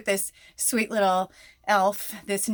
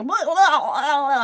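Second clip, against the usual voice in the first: high, louder mock gush of chatter; mock babbling rant; 8 semitones higher, 10 dB louder, slower than usual. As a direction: spill it all out in a rush. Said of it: "verbally vomit all over her".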